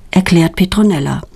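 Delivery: plain reading voice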